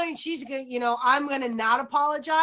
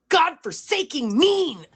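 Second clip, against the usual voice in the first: emphatic rant; 3 semitones higher, 5 dB louder, slower than usual. Real, not acted. Mother venting to friends about her teen, loud and emphatic. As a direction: lean hard on every word, exasperated. Ranting about mean teenagers.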